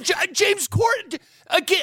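exasperated outburst. Real, not acted. To friends about the nominated actor, exasperated high outburst. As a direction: spit the name out, fed up.

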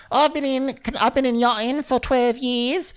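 very high-pitched mock accent; hammed-up imitation of a crewmate introducing herself; squeaky-high, exaggerated accented impression; mimicked crewmate's accent. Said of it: "Is that a Canadian accent?".

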